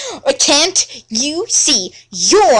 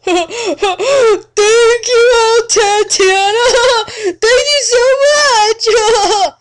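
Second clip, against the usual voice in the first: high, louder, laughing through tears; thrilled thanks, breaking into giggles; slow, hammed-up joyful sob; overjoyed giggling; 10 semitones higher, 3 dB louder than usual. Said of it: "He sounded so happy".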